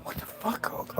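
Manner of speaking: whispered disbelief under the breath; whispered 'what the fuck'; whispered aside. Pretend. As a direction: mutter it so nobody hears.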